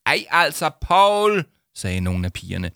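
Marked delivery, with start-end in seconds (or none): 0.1-1.4 high, loud whine of complaint; indignant high-pitched groan at him; exasperated protest. Acted. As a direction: call him out with a drawn-out name. Girls complain at Paul.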